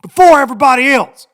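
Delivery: high, loud, slowed yell of an irate passenger; mock outraged passenger screaming; screaming demand. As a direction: shriek it at the lady like you're owed the plane. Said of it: "he's, like, screaming at this lady".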